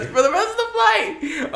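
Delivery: very high laughing squeal; laughing self-correction. Real, not acted. Storyteller to teasing friends over wording slip, high laughing squeal. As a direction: squeal the phrase through laughter.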